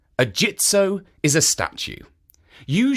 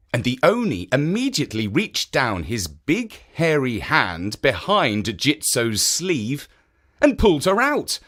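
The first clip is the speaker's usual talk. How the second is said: plain reading voice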